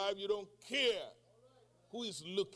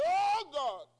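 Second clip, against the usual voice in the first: cry of pain; 8 semitones higher, 6 dB louder than usual. Retold or imitated. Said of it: "I say".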